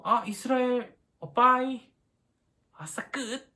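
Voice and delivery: high, loud pretend cheer; mock glee. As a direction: play-act a gleeful, good-riddance goodbye. Imagines Moses gleefully quitting Israel. (신나서 작별하는 척, 높고 크게)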